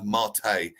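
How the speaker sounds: Mate in two syllables, mah-TAY; corrected pronunciation. Shown as a demonstration. Correcting the co-host, Mate said properly as mah-tay. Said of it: "It's got an accent".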